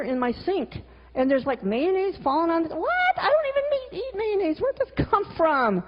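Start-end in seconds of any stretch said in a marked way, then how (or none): 2.6-5.8 high, incredulous squeak of disbelief; exasperated, much higher pitched; baffled outrage. Retold or imitated. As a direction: react like you just found mayonnaise everywhere. Finding a mess at home.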